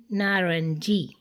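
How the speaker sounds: ordinary delivery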